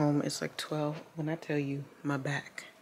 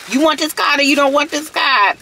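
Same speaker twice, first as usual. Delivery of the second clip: higher and louder, fed-up mama laying down the law; sassy ultimatum; 10 semitones higher, 13 dB louder than usual. Retold or imitated. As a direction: take it or leave it, sharp and loud. Retold telling off the kid.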